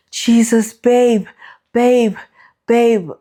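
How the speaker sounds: high, loud urgent calls of babe; calling husband to the bathroom, high and loud excitement; excited calling out. Retold or imitated. Waking husband after positive test.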